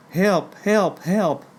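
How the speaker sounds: high, drawn-out calls for help; retold prayer for help. Hurt woman praying on the ground, high retold plea. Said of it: "I breathed a prayer".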